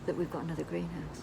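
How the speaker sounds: low, hushed secret whisper. Real, not acted. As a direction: hide it from Nadia. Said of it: "why we're whispering".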